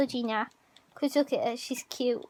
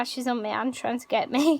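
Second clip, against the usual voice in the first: ordinary delivery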